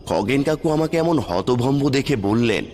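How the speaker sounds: usual speaking voice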